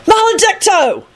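high-pitched cry of a curse; shouted spell. A narrator's reading. Alex casting a curse with his wand, shouting. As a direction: cast it with a high, contained shout. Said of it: "Alex shouted and waved his wand".

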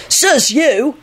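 high, stubborn retort; obstinate. A narrator's reading. Alex answering his dormmates back, obstinate. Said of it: "Alex replied obstinately".

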